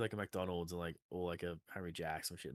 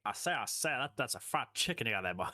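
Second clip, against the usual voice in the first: higher Southern cartoon rooster voice; 4 semitones higher, 4 dB louder than usual. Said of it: "I sound the fucking turkey from fucking Warner Brothers".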